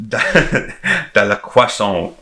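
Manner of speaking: slow mock French flourish. Podcaster extending the French name joke, mock French. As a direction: draw out a silly French name. Joking made-up French names.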